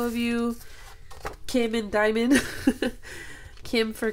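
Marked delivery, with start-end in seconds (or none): none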